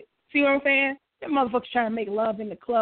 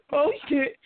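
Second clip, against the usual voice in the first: laughing; 10 semitones higher than usual. Real, not acted. Laughs at rival's remark.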